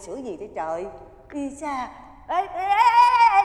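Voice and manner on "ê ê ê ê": calling out. Acted. Calling after the toddler wandering off.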